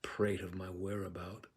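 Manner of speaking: hushed, measured verse speaking; quiet classical stage reading; Shakespeare recitation. Acted. Macbeth soliloquy recited.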